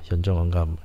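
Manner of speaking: ordinary delivery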